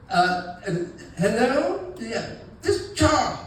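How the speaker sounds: lightly voiced nervous caller; sheepish Charles. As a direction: stall awkwardly before giving your name. Voicing Charles on the phone.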